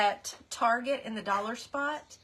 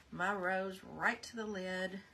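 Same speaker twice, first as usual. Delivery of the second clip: ordinary talk, nothing marked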